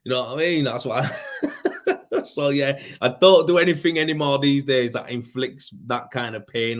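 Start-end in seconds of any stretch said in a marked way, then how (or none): 1.1-2.2 high laugh. Real, not acted. Laughs at own story.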